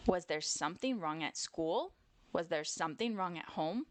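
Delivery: ordinary delivery, nothing marked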